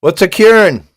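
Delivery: loud, high-pitched outburst of a question; loud demanding question. Real, not acted. Streamer puzzled by an unknown word, calling out loudly.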